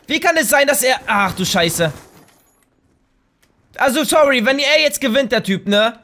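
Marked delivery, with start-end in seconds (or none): none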